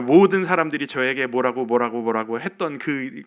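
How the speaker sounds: ordinary talk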